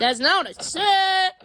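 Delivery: mock protest. Objects to Ben's echo.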